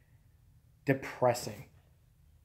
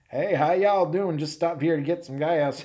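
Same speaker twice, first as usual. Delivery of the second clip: put-on Southern accent. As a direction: fake a folksy Southern accent to pass as local. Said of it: "talk in the Southern accent".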